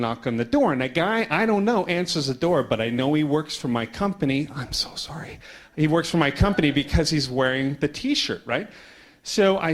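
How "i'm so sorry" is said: soft, whispery aside; hushed apology. Real, not acted. Speaker breaks off the story to apologise, hushed aside. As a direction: drop briefly to an apologetic whisper.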